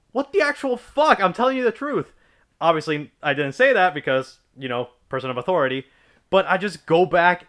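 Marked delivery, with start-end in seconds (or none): 0.1-2.1 high, incredulous retold protest; mock outraged denial, higher pitched; indignant disbelief. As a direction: throw up your hands at the accusation. Retold outburst at the dean.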